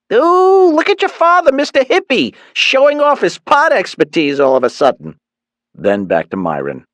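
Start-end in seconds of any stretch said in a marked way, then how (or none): 0.1-5.2 high, loud teasing mother's voice; teasing Mom. A narrator's reading. Mother mocking father's pot knowledge, high teasing voice. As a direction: tease the father, pitched way up.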